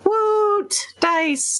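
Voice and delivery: high, bright whoop of joy; excited cheer. Real, not acted. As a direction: cheer it like a happy fan. Cheering about the dice giveaway.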